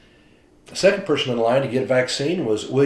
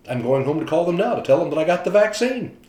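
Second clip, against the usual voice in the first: higher and louder, bursting with joy; thrilled; 7 semitones higher, 6 dB louder than usual. Retold or imitated. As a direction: share the happy news eagerly. Martin thrilled about calling family.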